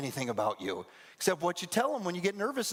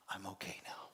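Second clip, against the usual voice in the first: whispered self-reassurance; 8 semitones lower, 15 dB quieter than usual. Retold or imitated. Fearful self-talk.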